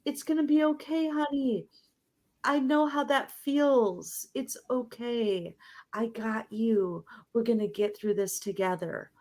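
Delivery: soft, fast, tender reassurance; a mother comforting her frightened child; soothing mom. Retold or imitated. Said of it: "and we, like".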